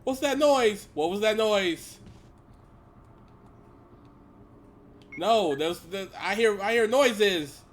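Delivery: high, loud frightened cries; scared. Real, not acted. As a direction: yelp it out, spooked. Scared by noises in game.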